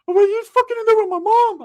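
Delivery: put-on high, loud whimpering teen voice; crying cousin voice. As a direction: wail it like a scared kid cousin. Cousin Ricky answering.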